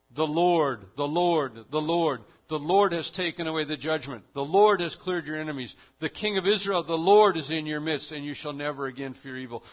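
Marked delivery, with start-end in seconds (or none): 0.1-8.1 each "Lord" hit hard and loud, falling; emphasis on the Lord. Real, not acted. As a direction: proclaim "The Lord" louder every time, like a drumbeat. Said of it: "you see the emphasis".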